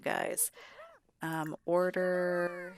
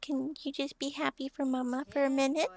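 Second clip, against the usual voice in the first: high, sing-song mama voice; 8 semitones higher than usual. Real, not acted. Mother coaxing her grumpy son, high cooing baby talk.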